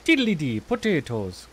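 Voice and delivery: put-on Irish accent. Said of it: "you could be Irish".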